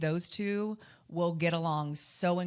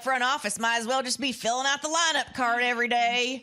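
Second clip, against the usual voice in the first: put-on grumpy old-timer, higher and louder; old man voice; 6 semitones higher, 5 dB louder than usual. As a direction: crank it like a cranky old baseball guy. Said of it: "I do that old, the old man voice?".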